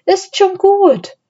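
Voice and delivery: high-pitched cooing comfort; consoling. A narrator's reading. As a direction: soothe a sad puppy in a high voice. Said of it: "tröstete Anne ihn".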